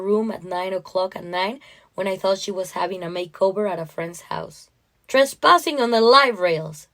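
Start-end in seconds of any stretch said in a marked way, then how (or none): 5.1-6.9 stern, official pronouncement; judge passing sentence. Acted. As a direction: deliver it like a verdict. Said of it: "in the tone of a judge passing sentence".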